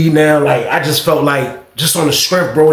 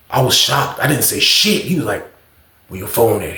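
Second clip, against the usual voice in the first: lower, menacing tough-guy voice; big dog threat; 6 semitones lower than usual. Retold or imitated. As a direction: talk down to him like the boss of the block. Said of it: "talking to me like he a big dog".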